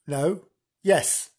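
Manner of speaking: ordinary talk, nothing marked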